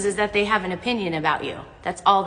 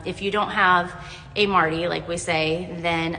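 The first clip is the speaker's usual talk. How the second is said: ordinary talk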